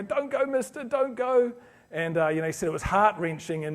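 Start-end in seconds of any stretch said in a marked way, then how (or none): 0.0-1.5 put-on high pleading child's voice, lightly tearful; a little girl begging the soldier not to leave, voiced high and tearful; mock crying plea. Little girl clinging to soldier's leg, high tearful pleading. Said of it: "and she's crying".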